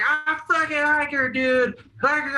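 high, hammed-up drunken wail; mock crying. Drunk friend on the curb confessing his crush, mock crying. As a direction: wail it high like a lovesick crybaby. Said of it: "and he's crying".

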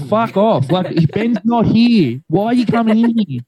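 imitating an exasperated, high-pitched protest; retold exasperation. Retold telling mum to leave.

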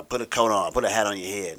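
loud mock parental yell; yelling parents. Parents order their teenager to dress warmly, yelling. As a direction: call it out like a nagging parent. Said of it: "my parents yelling at me".

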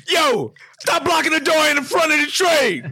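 high yelling train conductor; aggressive conductor shout. Retold or imitated. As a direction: bark it over the intercom. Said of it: "that's a little aggressive".

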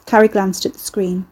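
plain reading voice

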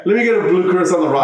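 animated, fast imitation of a customer; amused retelling. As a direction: rattle off the quote in amused disbelief. Repeats the order, amused.

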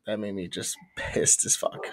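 low, breathy whispered grumble; annoyed under-the-breath whisper. Real, not acted. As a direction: mutter it under your breath, still annoyed. Muttered complaint at end of story.

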